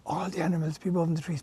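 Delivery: ordinary delivery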